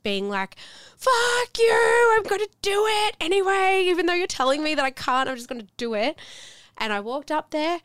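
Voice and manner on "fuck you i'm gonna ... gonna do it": high, loud mock yell, easing off; retold defiant scream. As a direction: yell back at your own doubts, then settle. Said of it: "I was just screaming at my mind".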